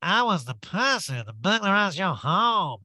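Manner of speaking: exaggerated, sing-song caricature of a burglar. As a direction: ham up the crook's confession. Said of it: "he reached out to my sister-in-law and said".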